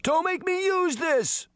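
high, slow, desperate threatening cry; frightened boy's shrill-free high shout, slow and desperate; shouting threat. Acted. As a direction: warn them off with your last weapon. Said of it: "he shouted".

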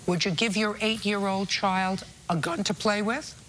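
ordinary delivery

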